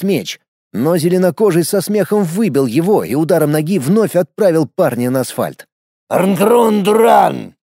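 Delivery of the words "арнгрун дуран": slow, booming orc voice; barbarian. Acted. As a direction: bellow it like a brutish orc. Orc speaks orcish.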